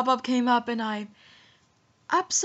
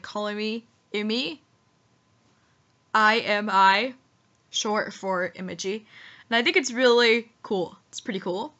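normal talking voice

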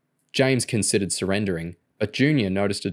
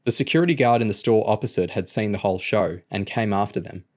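plain reading voice

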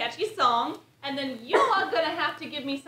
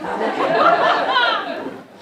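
big laugh; 10 semitones higher, 11 dB louder than usual. Real, not acted. Crowd-facing improv opener.

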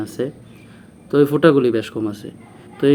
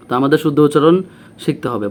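ordinary talk

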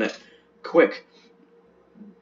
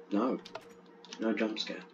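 ordinary talk, nothing marked

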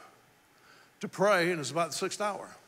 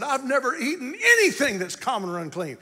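raised, laughing-crying protest; Peter protesting; 8 semitones higher, 7 dB louder than usual. Acted. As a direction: plead your purity. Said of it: "And he said".

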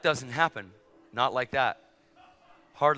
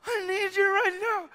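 high, slow, strained wail; crying plea; slower than usual. Retold or imitated. Flight attendant falling into preacher, crying. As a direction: imitate a desperate tearful begging. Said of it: "She's crying".

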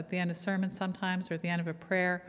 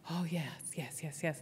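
much softer murmured yeses; hushed approval; 2 semitones lower, 9 dB quieter, slower than usual. Retold or imitated. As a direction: murmur approval under your breath. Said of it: "They went".

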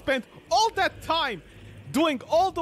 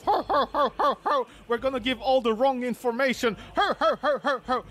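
hammed-up gloating ho-ho laugh; mock laugh; 2 semitones lower than usual. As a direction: cackle like a smug know-it-all. Mocking laugh of rival creators.

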